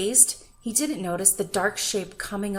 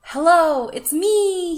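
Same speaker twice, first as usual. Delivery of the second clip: high, loud call to his friends; 8 semitones higher, 11 dB louder, slower than usual. A narrator's reading. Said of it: "he called to his friends".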